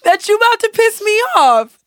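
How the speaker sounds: high, loud playful telling-off; mock scolding. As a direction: call them out in pretend outrage.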